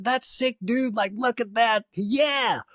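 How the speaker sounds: high-pitched mock dude-speak; hyped fan impression. Fans in the queue admiring artwork, high hyped imitation. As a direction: imitate an over-excited gig-goer, pitched right up.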